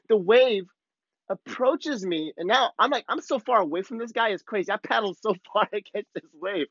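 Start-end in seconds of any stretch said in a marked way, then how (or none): none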